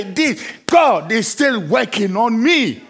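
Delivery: slow, loud declaration of faith; loud preaching climax. Acted. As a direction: proclaim it at full force. Preacher climax then quiet repeats.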